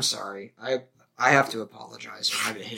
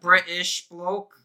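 slow, higher, hammed-up British accent; English impression; 6 semitones higher, slower than usual. Repeating it bigger for his cohost, slow mock British. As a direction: stretch it out like a stage Englishman. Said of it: "English man impression".

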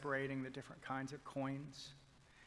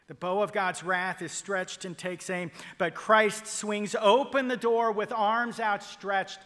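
loud, soaring pulpit refrain; the preacher's loud, rising call to repent; preaching at full force; 6 semitones higher, 13 dB louder than usual. Acted. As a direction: thunder the refrain to the congregation. Climactic repeated gospel refrain.

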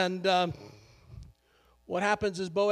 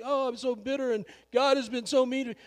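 mock-moan her losses like a sulking widow; bitter Naomi; 7 semitones higher, 4 dB louder than usual. Preacher mocking a bitter Naomi, high whiny lament. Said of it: "I'm like".

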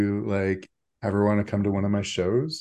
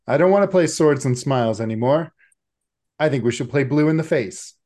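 loud, higher, fast tantrum; petulant outburst; 6 semitones higher, 7 dB louder, faster than usual. Acted. Necktie smacking the table at the group, loud petulant outburst. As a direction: slam it down like a sulking child demanding a new game.

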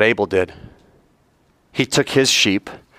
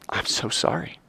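soft apology; 10 dB quieter than usual. Acted. Abel's humble attitude recalled.

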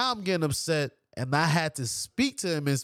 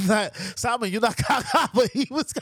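breaking into laughter mid-sentence; can't hold the laugh in; 6 semitones higher than usual. Real, not acted. Telling his friend Simon he's not ugly, cracking up.

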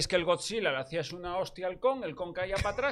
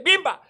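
mock punch sound; 10 semitones higher, 7 dB louder than usual. Imitating the monkey's punch.

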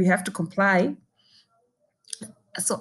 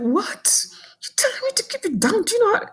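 much higher, fast incredulous retort; outraged disbelief; faster than usual. Retold or imitated. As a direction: fire it back in shocked disbelief. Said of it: "And I was like".

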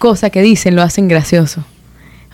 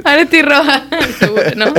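laughing; 6 semitones higher than usual. Real, not acted. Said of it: "no nos puede parar de reír".

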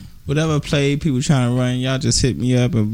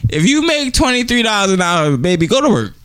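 raised-pitch playful voice; playful high voice; 6 semitones higher than usual. Pretend. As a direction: tease it, pitched up. Playing the man who pays.

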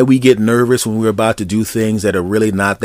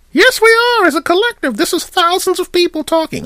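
very high put-on voice for the whole audience; crowd of listeners voice. Host voicing all his listeners answering, very high put-on voice. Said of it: "me making a voice myself".

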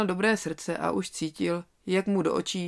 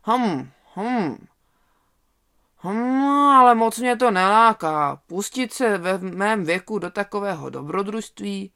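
grumbling; 3 semitones higher, 7 dB louder than usual. A narrator's reading. Old wolf sits and grumbles at the lamb's plan, reluctant. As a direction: grumble it as if weighing it up unwillingly. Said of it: "bručel".

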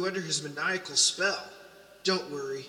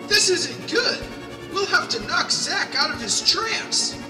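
alarmed; 10 semitones higher, 6 dB louder than usual. Acted. Panic in fight.

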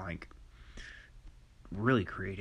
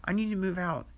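quoted woman; 9 semitones higher, 6 dB louder than usual. Retold or imitated. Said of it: "a woman looks at you and says".

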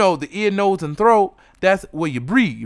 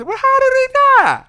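much higher, louder mock voice; mock asking relative; 5 dB louder than usual. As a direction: squeal the question in a put-on voice. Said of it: "for them to say".

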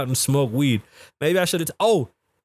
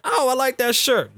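higher-pitched imitated gushing; mock admiring exclamation; 9 semitones higher than usual. Passers-by complimenting his [name] shirt, mock gushing.

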